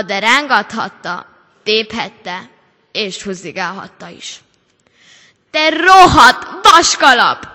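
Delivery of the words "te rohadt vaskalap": loud, high, strained cry of effort; groaned curse. A narrator's reading. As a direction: spit it out while straining against the hat. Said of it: "nyögte a lány". (nyögve, erőlködve kiáltva)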